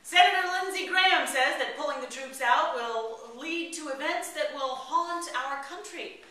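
ordinary talk, nothing marked

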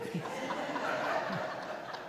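a soft airy laugh at her own joke; breathy laugh. Real, not acted. To the congregation after a dark joke, a soft airy laugh. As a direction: let a quiet, breathless laugh slip out.

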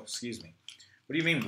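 normal talking voice

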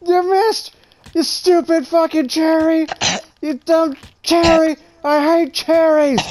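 high-pitched, gleeful jeering; heated taunting. Real, not acted. Player jeering at an opponent who missed, high heated taunt. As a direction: taunt them with rising insistence.

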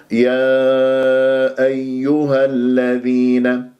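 يا أيها الذين slowly, long yā and clear doubled yā; measured, drawn-out recitation of يا أيها الذين; model recitation. Shown as a demonstration. Teacher models the verse for students, slow careful tajweed recitation. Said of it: "بهذه الطريقة".

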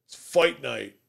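rowdy, loud, hyped-up bar brawler; mock hype shout. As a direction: shout it like you're itching for a fight. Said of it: "I'm not going to the bar going like".

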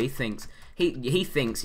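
ordinary delivery

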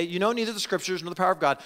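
fast, light re-reading of the verse; fast casual quote; quick run-through. Shown as a demonstration. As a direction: rattle it off quickly. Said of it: "to show them".

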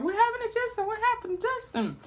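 imitating worried aunts; fussing relatives. Relatives crowding round the hurt boy, mock worried.

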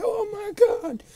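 high wailing oh my god; a real cry of dismay; anguished cry. Real, not acted. Gamer wailing over lost audio recording.

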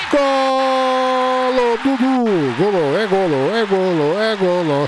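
high shouted goal call; explosive goal commentary. Acted. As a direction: belt out the goal. Another goal scored.

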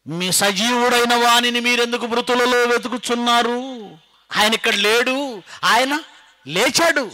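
high, loud resurrection preaching; full-force proclamation. Acted. Angel's news to the disciples, loud triumphant proclamation. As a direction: proclaim it to the whole hall, easing slightly later.

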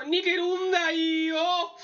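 high, slow, drawn-out battle call; hammed-up catchphrase, stretched long; anime technique cry. Recapper parodies the hero fleeing by a famous technique, drawn-out anime cry. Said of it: "hace la técnica definitiva de los yoyos".

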